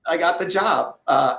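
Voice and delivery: normal talking voice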